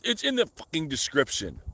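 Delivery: mock snappy streamer voice; imitated snap. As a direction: snap back like the rude streamer. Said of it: "he, like, snapped at me".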